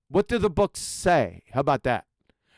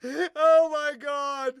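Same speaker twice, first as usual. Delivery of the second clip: high, drawn-out words through laughter; laughing disbelief; 7 semitones higher, much slower than usual. Real, not acted. Host reacting to a guest's mispronounced word, helpless laughing disbelief. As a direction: groan it slowly while cracking up.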